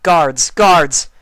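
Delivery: loud, high urgent call. A narrator's reading. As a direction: shout for the guards in alarm. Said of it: "Celestia called out".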